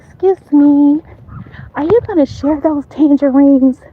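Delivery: high-pitched, pretend neighborly ask; rehearsed polite request. Rehearsing asking neighbor for tangerines.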